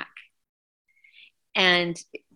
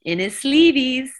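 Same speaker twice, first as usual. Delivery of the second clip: higher, louder, slower goofy voice; lightly voiced bandmate landing a corny punchline; joke punchline voice; 8 semitones higher, 8 dB louder than usual. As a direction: deliver the pun in a silly put-on voice. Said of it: "And he goes".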